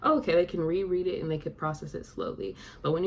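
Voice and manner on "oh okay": high, louder mock "aha"; pitched-up, lightly acted understanding; imagined reader's realization. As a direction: imitate a reader suddenly getting it. Acting out a reader catching on.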